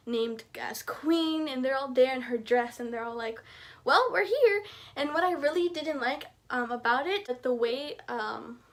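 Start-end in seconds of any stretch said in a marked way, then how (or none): 3.8-4.6 much higher and louder, bright announcement; cheerful arrival. Acted. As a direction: burst in happily announcing yourselves. Friends arriving at coronation.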